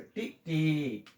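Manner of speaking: टी, टी drawn out very slowly; slow demo. Shown as a demonstration. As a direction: stretch each टी out for the class. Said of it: "बड़ी मात्रा जो है". (टी, टी को बहुत धीरे बोलें)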